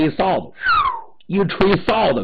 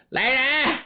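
high summoning cry for guards; bully yelling for his men; high shout; 10 semitones higher than usual. Acted. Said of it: "吴胖子高声喊".

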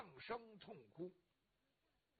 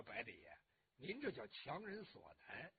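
low, quiet and restrained; restrained reply; 7 semitones lower, 8 dB quieter than usual. A narrator's reading. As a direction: hold back, voice dropped low. Wronged man answers the bully.

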